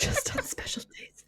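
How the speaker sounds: high breathless whisper. Pretend. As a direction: echo it in a secret whisper. Echo in whisper.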